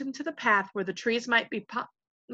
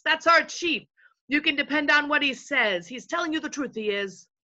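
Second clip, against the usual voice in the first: eager, higher-pitched yes-men; chorus of followers; 7 semitones higher than usual. A narrator's reading. Invisible followers vouching for their chief, eagerly. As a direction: chime in to back the boss.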